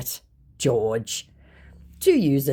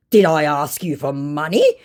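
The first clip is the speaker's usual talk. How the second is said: mock indignant; 3 semitones higher, 6 dB louder than usual. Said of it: "unironically indignant response".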